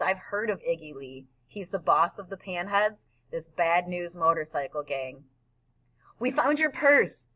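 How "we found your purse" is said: high, sudden nervous blurt; kid's high-pitched anxious outburst. A narrator's reading. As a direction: blurt it out high, all at once. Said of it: "Freak blurts out".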